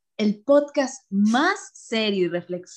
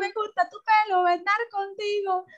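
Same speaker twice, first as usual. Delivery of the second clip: much higher, hammed-up flirty voice; mock high voice; 10 semitones higher than usual. Bella mocking the admirer in a high, hammed-up flirty voice. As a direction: camp it up in a high pitch.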